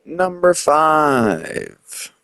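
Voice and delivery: drawn-out announcer call, high pitch; countdown announcer. Acted. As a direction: stretch it out like a countdown host. Announcing ranking number.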